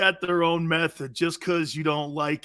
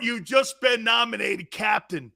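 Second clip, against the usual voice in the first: usual speaking voice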